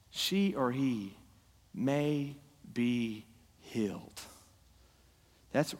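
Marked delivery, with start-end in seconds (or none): 0.1-4.2 slow, fading to soft; slow solemn fade. Acted. Pastor closes the verse for hurting spouses, slow and fading. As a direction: let each phrase sink and quieten.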